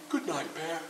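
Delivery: soft drowsy goodnight; sleepy. Acted. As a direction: murmur it half asleep. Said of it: "said Rabbit sleepily".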